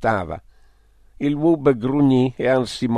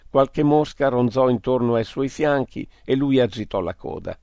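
plain reading voice

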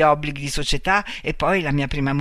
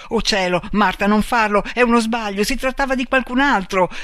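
high, louder, urgent pleading; frantic cry; 6 semitones higher, 6 dB louder than usual. A narrator's reading. Man begs Marta not to shoot, crying out frantically. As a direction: beg in a panic, voice raised. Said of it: "gridò lui".

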